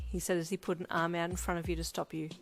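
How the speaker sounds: ordinary delivery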